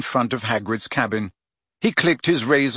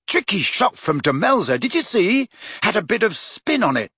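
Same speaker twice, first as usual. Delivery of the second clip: higher-pitched happy boasting; 8 semitones higher than usual. A narrator's reading. Ron describes a tricky shot to friends, happy. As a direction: replay the save with lifted, delighted pitch. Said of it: "Ron was saying happily".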